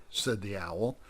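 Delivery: plain reading voice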